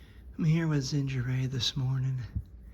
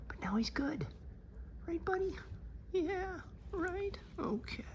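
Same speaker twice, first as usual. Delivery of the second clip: talking to a dog; 8 dB quieter than usual. Real, not acted. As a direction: coo it up high and gentle. Cooing at the dog.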